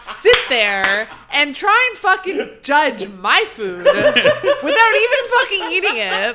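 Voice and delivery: loud, high, laughing mock fury; hammed-up outrage, laughing through it; mock outraged rant. Mock outraged rant at Dutch friend.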